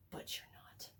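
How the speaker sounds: the queen's line hushed to a whisper; whispered threat. Retold or imitated. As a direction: whisper it, a mock-menacing hush. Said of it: "and the queen's like".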